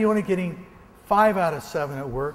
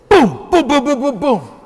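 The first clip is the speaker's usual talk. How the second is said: loud, punchy shouted booms, each one a shot; loud imitated gunfire, boom after boom; mock gunshots; 8 semitones higher, 10 dB louder than usual. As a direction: fire off the booms like a pistol going off. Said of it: "So I take the gun and I go".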